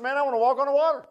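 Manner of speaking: high, fast, excited Peter; eager Peter. Retold or imitated. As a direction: blurt it like an eager fisherman. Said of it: "Peter said".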